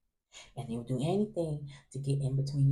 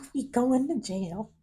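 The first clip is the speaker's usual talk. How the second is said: mock panic; 10 semitones higher than usual. Panicking in the lineup, mock panic. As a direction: fret about jail. Panic about going to jail.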